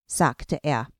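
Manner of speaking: ordinary delivery, nothing marked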